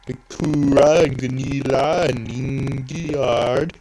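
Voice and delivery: names said in a put-on Swedish accent. Shown as a demonstration. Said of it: "a stereotypical Swedish accent".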